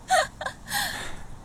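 a soft, rising laugh pitched very high; high gloating laugh. Acted. Vixen gloating over the fallen shogun, high rising laugh.

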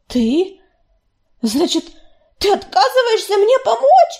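put-on the young cat refusing to believe it; indignant young she-cat. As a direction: throw the question at him, stung. Белочка confronts him.

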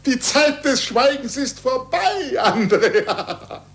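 slow words broken by laughter; laughing with joy. Acted. Scientist tells his pupil silence is over, laughing with joy. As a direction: laugh it out in relief. Scientist rejoices at new pope.

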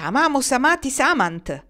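loud, high cross declaiming; emphatic, piqued recitation; irritated retort. A narrator's reading. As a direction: throw the Latin at them, vexed. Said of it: "rispose stizzita".

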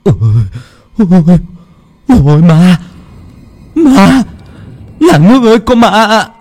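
very high, loud ghost scream; terrified scream. A narrator's reading. Dụng fallen on the ground screaming about the ghost. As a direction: shriek for help in terror. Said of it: "ngã lăn ra đất mà ú ớ".

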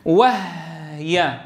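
وهي drawn out very slowly with the ha held silent; وهي with a still ha, very slow; silent ha in wahy. Shown as a demonstration. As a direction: stretch وهي and keep the ha sukun clear. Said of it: "ركزوا على الهاء ساكنة".

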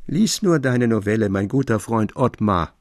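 ordinary talk

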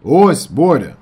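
loud, high shout back. A narrator's reading. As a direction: yell to get through on the phone. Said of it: "кричав я йому".

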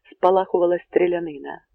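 plain reading voice

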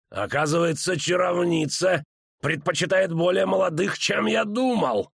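high, slowing sneer, lightly growled; growling villain. A narrator's reading. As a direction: snarl it with rising pitch, stretching the end. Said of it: "зарычал он". (рычащая издёвка злодея)

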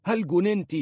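high, loud burst of disbelief; outraged shout. A narrator's reading. Uncle shouts at niece after her confession, outraged. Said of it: "صاح في وجهها".

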